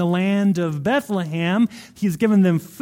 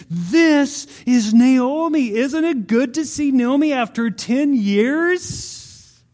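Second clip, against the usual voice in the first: higher, amazed welcome from the townswomen; 6 semitones higher, slower than usual. Retold or imitated. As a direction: greet a long-lost friend in delighted amazement. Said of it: "all the ladies are amazed".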